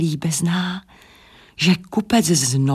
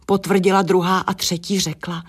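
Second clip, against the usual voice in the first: ordinary delivery, nothing marked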